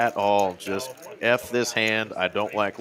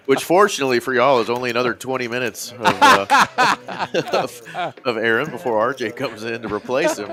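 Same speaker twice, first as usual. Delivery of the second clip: laughing; 4 semitones higher, 5 dB louder than usual. Real, not acted. Laughing through chat joke.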